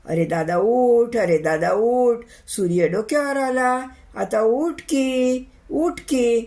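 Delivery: loud, insistent wake-up calls; repeated calling out. Acted. Neighbour trying to wake his friend, calling out insistently. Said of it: "त्यानं बी लय हाकारे घातले".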